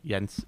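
Jens said as Yens, with a Y; soft J. Shown as a demonstration. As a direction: start Jens on a soft Y sound. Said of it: "It's a soft J".

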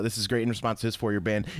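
ordinary talk

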